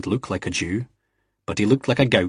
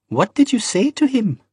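put-on curious young barmaid, much higher voice; 14 semitones higher, 3 dB louder than usual. A narrator's reading. Barmaid quizzing Jamie, high and curious.

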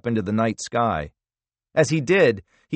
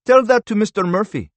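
smirking; 11 semitones higher, 6 dB louder than usual. A narrator's reading. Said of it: "Toman smirked".

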